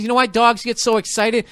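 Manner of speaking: normal talking voice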